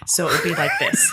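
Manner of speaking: ordinary delivery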